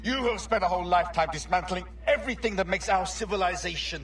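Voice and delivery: angry outburst. Acted. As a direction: spit it out in contempt, pushing fast. Heated confrontation with the assassin.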